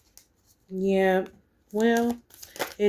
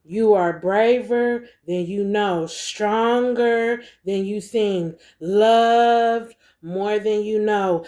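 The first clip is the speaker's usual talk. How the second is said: reads "You are braver than you know" slowly, each word spaced out; slow card reading; much slower than usual. Shown as a demonstration. Reading the card's message to the viewer, slow and deliberate. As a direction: let the card's words land slowly, one by one. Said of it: "Look what this say".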